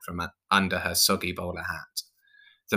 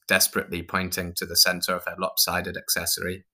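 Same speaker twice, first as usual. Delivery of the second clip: usual speaking voice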